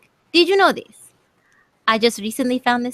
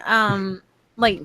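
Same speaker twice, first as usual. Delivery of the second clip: ordinary delivery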